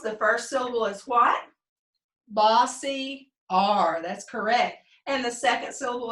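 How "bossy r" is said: "Bossy R" very slow, word by word; drawn-out answer. Shown as a demonstration. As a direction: lead the class in a slow "Bossy R". Teacher leads class chanting answer.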